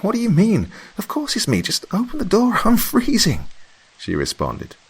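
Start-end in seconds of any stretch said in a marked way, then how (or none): 0.0-3.5 very high, louder voice for Mary; a cold friend begging to be let in; Mary, pleading. A narrator's reading.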